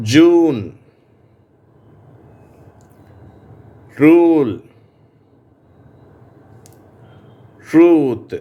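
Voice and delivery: ஜூன், ரூல் with a long drawn oo; long oo sound. Shown as a demonstration. Teacher modelling long oo words for students, clear. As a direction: stretch the oo in ட்ரூத். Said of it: "ஊ என்ற உச்சரிப்புள்ள வார்த்தைகள்".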